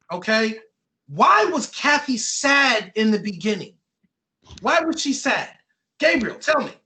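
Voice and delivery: ordinary delivery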